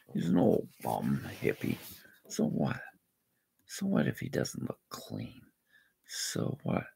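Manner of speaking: breathy whisper of defiance; hushed. Real, not acted. Defending the hippie he helped, whispered. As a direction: whisper it, almost to yourself.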